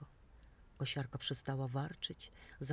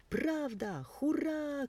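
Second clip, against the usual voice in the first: joyful cheer; 10 semitones higher, much slower than usual. A narrator's reading. The meadow creatures cheering together, high and joyful. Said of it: "krzyczeli mieszkańcy".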